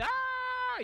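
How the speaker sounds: high crowd scream "Ah"; high crowd yelp; crowd gasp. Retold or imitated. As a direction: shriek as the crowd. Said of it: "Y la gente".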